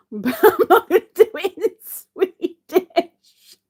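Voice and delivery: high, loud words through laughter; laughing refusal. Real, not acted. Teased about her Swedish accent, laughing refusal. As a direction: giggle while refusing.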